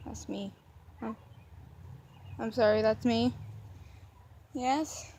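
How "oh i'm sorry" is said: usual speaking voice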